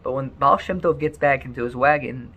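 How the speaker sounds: usual speaking voice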